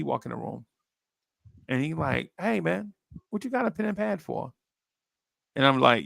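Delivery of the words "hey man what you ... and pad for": much higher, quick teasing question; lightly voiced amused billionaire; mock billionaire voice. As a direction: poke fun at the newcomer.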